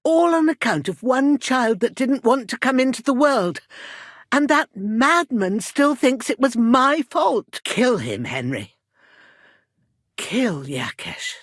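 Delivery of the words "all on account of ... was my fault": high, raised, bitter complaint; aggrieved rant, pitched high; indignant outburst. Acted. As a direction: protest the false blame heatedly. Acted bitter complaint at false blame.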